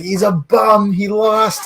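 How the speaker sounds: high, loud mocking taunt; taunting his nephew about John Cena losing. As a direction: rub it in like a gloating uncle. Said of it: "I'd be like".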